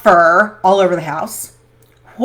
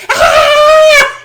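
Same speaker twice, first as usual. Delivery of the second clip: shrill high squeal of a screaming snake; 9 dB louder than usual. Retold or imitated. Retelling how the snipped snake screamed, high squeal. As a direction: mimic a tiny snake's scream, loud and high. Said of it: "the snake scream".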